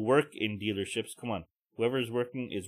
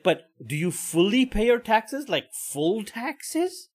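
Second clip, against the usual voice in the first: mocking the lady; 8 semitones higher, slower than usual. Ranting about a woman asking Syrians about taxes, mock prying voice. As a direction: drag out the nosy question, higher. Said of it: "she's like".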